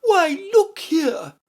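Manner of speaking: slow, very high exclamation; Red Queen crying out. A narrator's reading. Red Queen demanding Alice's attention, crying out. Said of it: "the Red Queen cried".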